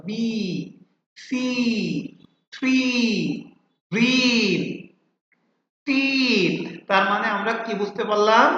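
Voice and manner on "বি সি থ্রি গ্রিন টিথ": each word alone with a drawn-out ee vowel; stretched long ee in "বি, সি, থ্রি, গ্রিন, টিথ". Shown as a demonstration. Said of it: "এটা long ই হয়ে গেল".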